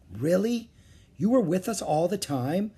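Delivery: high, questioning disbelief; astonishment. A narrator's reading. Jack learning Morgan was always with them, astonishment. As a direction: ask it high, hardly believing it.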